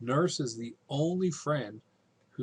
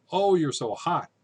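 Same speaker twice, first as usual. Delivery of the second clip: louder, higher chiding exclamation; mock indignation; 6 semitones higher, 7 dB louder than usual. Nurse answering Juliet's anger, mock indignant. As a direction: scold someone for their hot temper, lightly acted.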